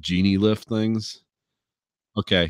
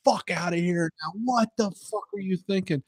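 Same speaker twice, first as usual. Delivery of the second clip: high, sharp hammed-up scolding; mock angry yelling; 12 semitones higher than usual. Boss scolding the contractors, high mock rage. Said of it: "They're like".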